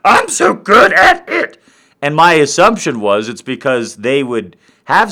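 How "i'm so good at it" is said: loud, high mock brag; boasting guy. Guy bragging to everyone about sex, loud high boast. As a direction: crow it loudly like a cocky fool. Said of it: "every guy's walking around going like".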